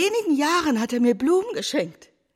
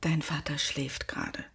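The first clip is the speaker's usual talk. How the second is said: softer, lower hushed whisper; a quiet unvoiced whisper; 8 semitones lower, 7 dB quieter than usual. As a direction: whisper so as not to wake him. Mother says father sleeps next door.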